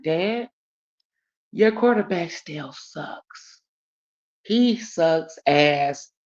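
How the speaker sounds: drawn out, slow dismissive taunt; mocking her dad's quarterback slowly. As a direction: stretch each word to needle him. Retold taunting dad.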